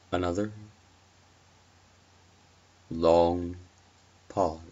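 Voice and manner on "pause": plain reading voice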